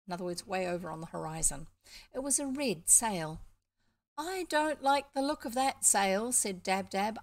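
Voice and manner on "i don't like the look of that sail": lightly voiced worried duck, higher pitch; uneasy duck. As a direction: give a hint of an anxious duck raising a warning. Uneasy duck warns of trouble.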